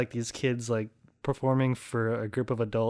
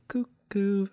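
put-on kids' cuckoo call; 7 semitones higher than usual. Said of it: "And then they go".

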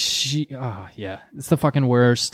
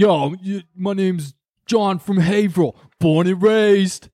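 mock local bro intro, pitched up, drawn out at the end; mocking the weatherman; 5 semitones higher, slower than usual. Podcast host mocking the smug TV weatherman, mock bro voice. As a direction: ham up a smug Haverhill guy introducing himself. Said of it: "it is fucking awful".

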